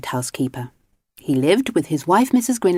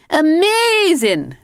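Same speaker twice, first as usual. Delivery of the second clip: much higher, louder, slow cheer; 13 semitones higher, 5 dB louder, slower than usual. Acted. Missus Grinling cheering her husband on the wave, loud. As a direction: cheer him on. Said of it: "she cheered".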